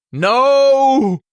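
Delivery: very loud, high roar; bellowed no. A narrator's reading. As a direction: bellow it with everything. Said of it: "I bellowed".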